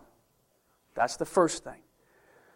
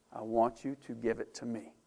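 gentle voice of God; 5 semitones lower, 9 dB quieter than usual. Acted. Voicing God's gentle request.

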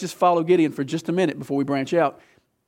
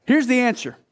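ordinary talk, nothing marked

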